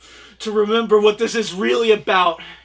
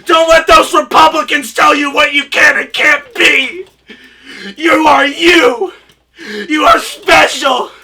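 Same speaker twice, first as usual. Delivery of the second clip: hammed-up impassioned speech; 3 semitones higher, 5 dB louder than usual. Collector rallying teens, hammed-up tearful loud speech. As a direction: preach it like a tearful activist. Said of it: "let me catch my breath".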